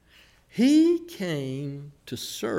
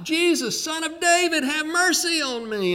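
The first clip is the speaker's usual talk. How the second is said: high, pleading call; crying out; 12 semitones higher than usual. Retold or imitated. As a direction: call out desperately from the roadside. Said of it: "he began to cry out".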